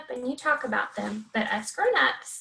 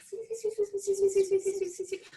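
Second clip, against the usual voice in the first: high squeaky mouse voice; playful high mouse squeaks for the kids; 6 semitones higher than usual. Shown as a demonstration. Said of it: "can you make it squeak".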